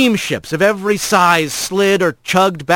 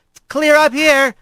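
higher voice called up from the rail; calling out; 12 semitones higher than usual. Acted. Rat calling down to the otter, pitched-up call. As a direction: sing out to the otter below. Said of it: "Rat called".

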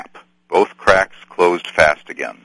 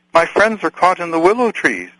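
much higher, breathless and urgent; breathless cry; 12 semitones higher than usual. Acted. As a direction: blurt it out in a panic. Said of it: "cried Frodo breathlessly".

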